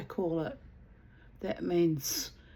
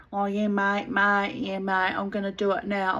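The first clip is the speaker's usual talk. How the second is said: a broad Aussie voice, louder, stretching each mate; ochre Aussie; 2 semitones higher, 5 dB louder than usual. Retold or imitated. The neighbour fobbing her off in a broad Aussie accent. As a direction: do a lazy broad Aussie promising it's done now. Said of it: "what we call ochre Aussie".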